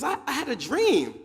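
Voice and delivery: high, loud, stammered start; excited announcement. Acted. Chicken announces his dream.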